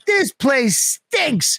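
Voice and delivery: high, drawn-out mock disgust; retold disgusted complaint. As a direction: stretch each word out in imitated indignation. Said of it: "but Patrice was like".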